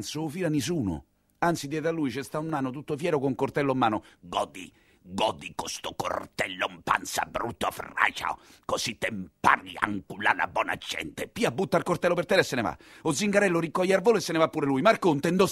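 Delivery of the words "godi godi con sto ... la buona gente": menacing gloat. Acted. Dwarf gloats over stabbed man.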